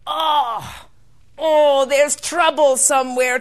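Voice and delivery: loud, alarmed exclamation; alarmed leprechaun. Acted. Leprechaun hearing a cry of human pain, alarmed. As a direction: cry out in sudden concern.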